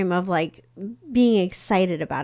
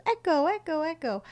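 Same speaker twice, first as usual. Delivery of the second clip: echo fading; 9 semitones higher than usual. Retold or imitated. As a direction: fade the repeats like a canyon echo. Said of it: "and it goes".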